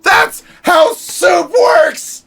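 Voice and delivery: very high, slow, shouted disbelief; exasperated shout. Retold or imitated. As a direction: yell it like it's obvious. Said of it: "And I was like".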